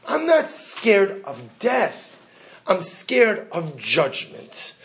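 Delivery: very slow, weighty delivery; grave, emphatic declaration. Retold or imitated. Dying rabbi explaining his fear to students, slow and emphatic. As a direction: spell out the fear slowly, word by word.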